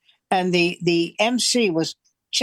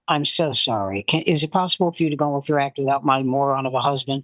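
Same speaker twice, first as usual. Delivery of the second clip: much lower, mock-polite request; apologetic wife; 5 semitones lower than usual. Wife to comic about her husband, politely apologetic. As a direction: switch to a composed, courteous apology.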